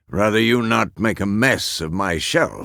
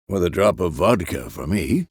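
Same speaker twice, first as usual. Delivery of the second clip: ordinary delivery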